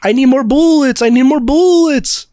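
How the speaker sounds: high, loud goofy French accent, hammed up. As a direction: play a silly French soldier begging for ammo. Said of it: "in his goofy accent".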